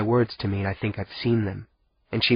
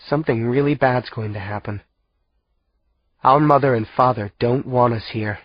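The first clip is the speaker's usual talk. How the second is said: usual speaking voice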